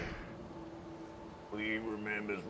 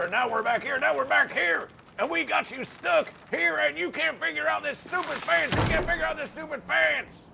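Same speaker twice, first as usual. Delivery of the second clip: high, louder gloating taunt; gloating villain; 9 semitones higher, 4 dB louder than usual. Acted. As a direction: crow over the trapped victim.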